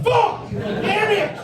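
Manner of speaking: very high-pitched comic yell; high mock outburst. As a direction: yelp the curse way up high. Acted contestant's outburst at reveal.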